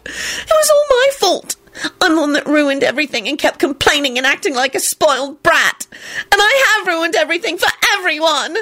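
high-pitched weepy screech. A narrator's reading. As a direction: screech the self-blame through tears. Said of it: "she screeched".

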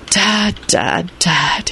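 soft, weary sighs; sighing. A narrator's reading. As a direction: sigh it out. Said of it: "he sighed".

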